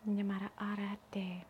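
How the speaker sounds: soft unvoiced whisper. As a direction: whisper it gently. Whispered closing of session.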